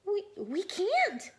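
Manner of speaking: high, slow, frightened stammer; scared. Acted. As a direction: tremble the refusal. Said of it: "said the little red fish".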